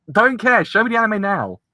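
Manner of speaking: high, loud insistent yell; mock demand. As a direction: insist like a spoiled fan. Demanding anime outside studio.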